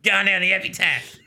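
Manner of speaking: high laughing Aussie punter voice; Australian accent. Retold or imitated. Friend joining the joke, laughing Australian accent. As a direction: call out "epitaph" like an Aussie racetrack. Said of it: "very much more Australian".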